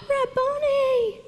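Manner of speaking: gasp, then a high drawn-out cry; acted amazed recognition; astonished joy. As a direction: gasp and call out the name, high and slow. Mary recognises the risen Jesus.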